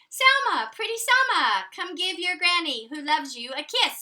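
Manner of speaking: high, loud singsong granny; Granny greeting her granddaughter, high and loving; loving granny call. Acted. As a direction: call her sweetly from afar. Granny voiced in a story.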